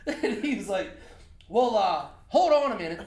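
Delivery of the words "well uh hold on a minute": high, loud mock Canadian border officer; Canadian accent. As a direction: excuse yourself in a hammed-up Canadian accent. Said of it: "Canadian accent".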